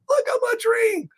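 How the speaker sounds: very high, tiny astonished squeal; squeaky amazement. Acted. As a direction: repeat it in a squeaky put-on voice. Repeats it much higher.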